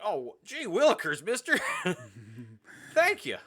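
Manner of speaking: high, loud gee-whiz voice; wholesome 1950s kid. Retold or imitated. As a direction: beam with gosh-golly gratitude. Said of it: "he's like".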